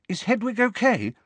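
high-pitched worried question; anxious. A narrator's reading. Hermione asking after the injured owl, anxious. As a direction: ask it anxiously, pitched well up. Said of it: "asked Hermione anxiously".

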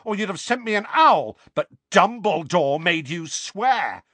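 loud, high, bitter shouting; angry rant. A narrator's reading. Harry ranting about Privet Drive, angry. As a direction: throw every grievance at them.

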